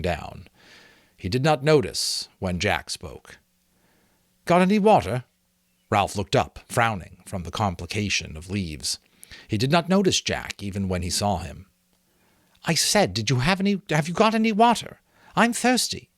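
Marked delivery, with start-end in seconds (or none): 4.5-5.2 high, loud, impatient call for water; thirsty boy's demand. A narrator's reading. Jack voiced, thirsty demand. 12.6-16.1 high, loud, impatient call for water; thirsty boy's demand. A narrator's reading. Jack repeats demand, impatient.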